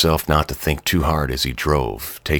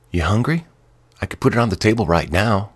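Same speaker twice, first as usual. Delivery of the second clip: higher, quick eager offer; eager wife; 7 semitones higher, faster than usual. A narrator's reading. Wife offering to serve dinner, nervous and eager. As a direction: rush to serve him, nervous and keen. Narrator voices Jess.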